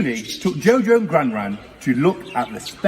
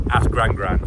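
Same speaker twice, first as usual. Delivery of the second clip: ordinary delivery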